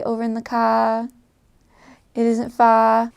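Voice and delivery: "car" and "far" ending in an open "ah"; Australian accent, "car" as "cah", "far" as "fah". Shown as a demonstration. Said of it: "when the R sounds like an ah".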